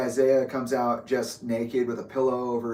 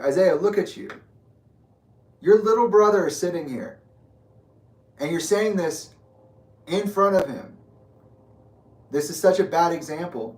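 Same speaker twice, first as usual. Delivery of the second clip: retold yelling; 6 semitones higher, 6 dB louder than usual. Confronting him in hallway.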